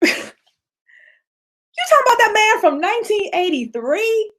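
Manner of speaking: laughing astonishment; incredulous laugh-talk. Real, not acted. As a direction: tease them in disbelief. Incredulous reaction.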